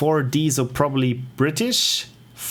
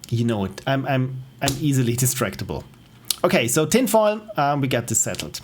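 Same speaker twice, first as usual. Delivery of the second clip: ordinary talk, nothing marked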